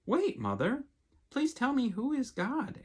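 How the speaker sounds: lightly voiced young bird asking; eager little bird. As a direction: ask it like a small chick tugging at mom. Young bird asks its mother.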